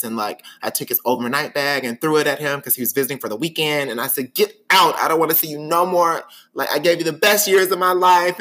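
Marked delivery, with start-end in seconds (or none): 4.3-8.4 put-on loud, high, crying yell at a boyfriend; a breakup yell that is half sob; retold tearful yell. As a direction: yell it out through mock tears. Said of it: "boo-hooing and crying".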